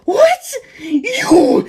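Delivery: very high, loud; high shocked villain. Acted. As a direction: shriek in disbelief. Antonio shocked.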